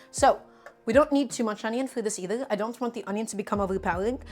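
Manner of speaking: usual speaking voice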